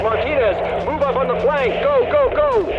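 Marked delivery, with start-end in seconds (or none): 1.8-2.7 urgent shouted command. Acted. Squad leader yelling at his team to advance, loud urgent shout. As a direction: call out go go go high and loud.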